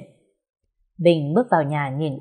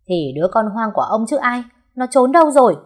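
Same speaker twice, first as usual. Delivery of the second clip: rising into a higher, louder shout; angry yell; 5 semitones higher, 5 dB louder than usual. Acted. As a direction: spit the insult out. Bình insulting the servant, enraged.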